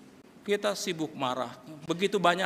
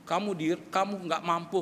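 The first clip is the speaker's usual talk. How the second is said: ordinary talk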